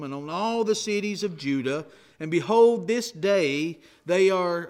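preacher reading Jeremiah to the congregation, solemn and loud; scripture reading. Acted. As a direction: proclaim it as the voice of the Lord. Reads God's words from Jeremiah.

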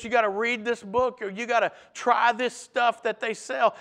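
ordinary talk, nothing marked

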